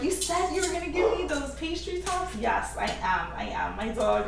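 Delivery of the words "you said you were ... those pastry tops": higher, pleading pet; begging dog voice. Retold or imitated. The dog asking for pastry tops, higher and pleading. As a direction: whine it like the dog wants his treat. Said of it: "The dog is barking 'cause he's like".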